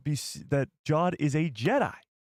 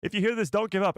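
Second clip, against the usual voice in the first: raised-pitch mock message; higher, hammed-up recorded message; mimicked hologram woman; 6 semitones higher, faster than usual. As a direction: quote her in a higher, put-on voice. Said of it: "She says".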